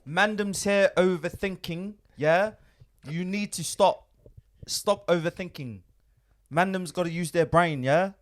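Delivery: playful London street slang; London roadman accent. Pretend. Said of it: "impersonation of English accents".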